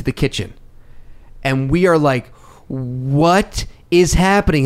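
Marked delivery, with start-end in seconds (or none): 2.7-4.6 slow, bewildered question; dragged out in shock; stunned disbelief. Retold or imitated. Horse enters the meeting.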